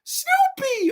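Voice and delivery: very high, loud cry for the dog; mock yelling. Imitating the movie family after their dog, high mock yell. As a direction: holler the name in a screechy falsetto. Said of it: "He is yelling for no reason".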